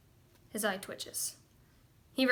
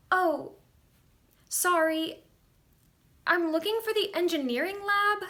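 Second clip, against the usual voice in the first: higher, hesitant apology; awkward, pitched-up student with pauses; 7 semitones higher than usual. Acted. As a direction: politely break it to someone eager. Female student interrupts, apologetic.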